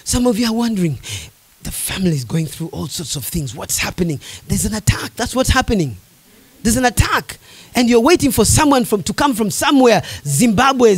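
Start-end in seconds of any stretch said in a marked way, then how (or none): 0.0-7.4 low, much softer; hushed. Real, not acted. Preacher to troubled families, hushed and low. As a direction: drop to a hushed confiding tone.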